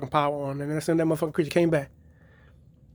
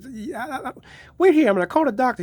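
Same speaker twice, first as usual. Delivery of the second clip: mock panicked wife; 8 semitones higher than usual. Frightened wife retold in story.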